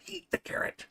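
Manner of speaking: high whispered coaxing; high whispered lure to the price. Pretend. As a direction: whisper it like luring a rabbit. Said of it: "coaxing whisper".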